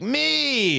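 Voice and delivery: high, drawn-out "Me!"; eager volunteer. Acted. Eager big volunteer calls out.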